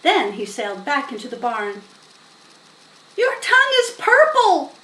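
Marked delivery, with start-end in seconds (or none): 3.2-4.7 put-on much higher, loud child's scream. A narrator's reading. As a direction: shriek it across the barn. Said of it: "screamed Fern".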